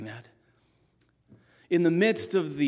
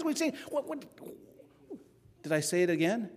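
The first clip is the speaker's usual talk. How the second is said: ordinary talk, nothing marked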